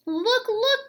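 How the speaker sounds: high excited cry. A narrator's reading. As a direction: point it out with a sudden high call. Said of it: "cried Diggory".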